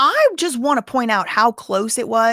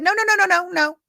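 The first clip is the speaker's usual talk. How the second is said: high, loud string of no; retelling the witness protesting to his lawyer, high and loud; mock objection; 12 semitones higher, 5 dB louder than usual. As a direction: shut it down, high and insistent.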